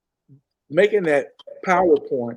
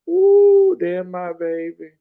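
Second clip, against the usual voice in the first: mother's fond greeting; 4 semitones higher, 4 dB louder, much slower than usual. Retold or imitated. Dying mother greets her son in uniform, fond and slow. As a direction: sing out Ooh like a proud mother.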